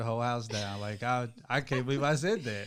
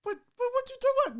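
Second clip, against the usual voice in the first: very high, fast squeal of disbelief; husband protesting wife's claim, high mock-outraged squeak; incredulous; much faster than usual. Real, not acted.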